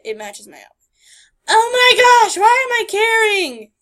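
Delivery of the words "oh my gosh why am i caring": loud high squeal; exasperated squeal. Real, not acted. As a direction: squeal at yourself. Laughing self-exasperation.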